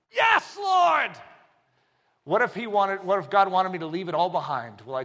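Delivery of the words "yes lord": high shouted yes; eager shout. Acted. Believer answering God.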